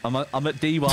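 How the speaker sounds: usual speaking voice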